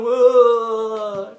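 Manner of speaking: very loud, high sobbing wail. Retold or imitated. Tough driver suddenly breaks down, loud wailing. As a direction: burst out in a howling cry.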